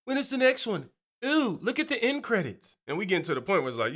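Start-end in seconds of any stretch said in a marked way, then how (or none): 0.0-2.8 high-pitched, excited fan voice; eager movie fan. Acted. Superhero fans glued to the screen, eager and high-pitched. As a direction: imitate eager viewers wanting more, up high.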